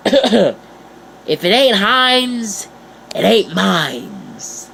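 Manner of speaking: slow, drawn-out slogan voice; hammed-up ad slogan, slow and savoured; slogan delivery. Shown as a demonstration. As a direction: announce it slowly like a catchy ad tagline. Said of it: "I even have a little slogan".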